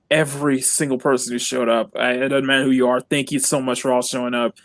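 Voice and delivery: ordinary talk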